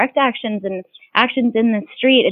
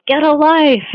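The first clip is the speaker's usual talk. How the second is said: higher, raised-voice heckle; imitated yell; 7 semitones higher, 4 dB louder than usual. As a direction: mimic a woman yelling out a window. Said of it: "this one woman yelled".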